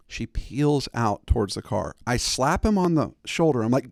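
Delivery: usual speaking voice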